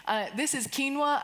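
ordinary talk, nothing marked

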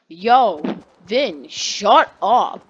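loud, drawn-out retold put-down; acted classmate ordering silence, slow and loud; bossy telling-off. Classmate tells the kid to butt out, bossy.

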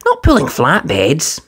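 high brisk engine voice; Thomas voice. Acted. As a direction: brush him off kindly. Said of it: "Thomas puffed".

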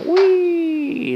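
loud high thrilled wih; excited whoop. Real, not acted. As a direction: yelp with delight. Car takes flight. (seru kegirangan)

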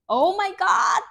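high, loud burst of delight; excited. Real, not acted. Reader thrilled at a lucky card for viewers, high excited squeal. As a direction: squeal it with real joy.

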